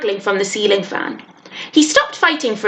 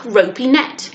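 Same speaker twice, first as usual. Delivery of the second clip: usual speaking voice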